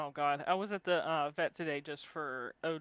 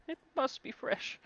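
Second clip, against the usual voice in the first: higher, pleading wail on "must"; whiny cat voice; 8 semitones higher than usual. Acted. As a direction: push "must" up high like a begging cat. Cat repeats its demand, higher.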